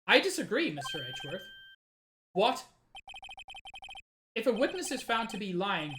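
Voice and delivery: ordinary talk, nothing marked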